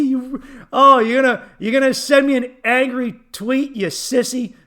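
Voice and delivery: high, loud mocking rant, laughing through it. Mock dad ranting at daughter over essay, loud and high. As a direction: yell it as a sneering hammed-up dad. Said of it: "I would be like".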